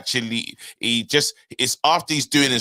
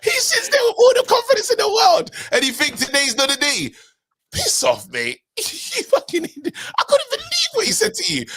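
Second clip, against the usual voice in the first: laughing rant. Real, not acted. Laughing rant about the guest.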